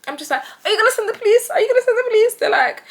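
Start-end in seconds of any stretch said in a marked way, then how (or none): 0.6-2.3 fake scared. Fake scared call continues.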